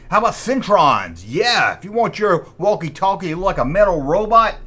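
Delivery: normal talking voice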